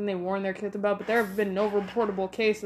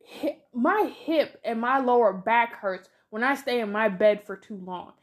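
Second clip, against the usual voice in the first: ordinary talk, nothing marked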